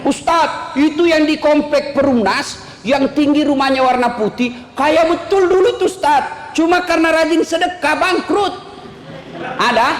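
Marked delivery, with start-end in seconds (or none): 0.1-8.5 congregant's reply. Pretend. Imagined congregant answers the preacher.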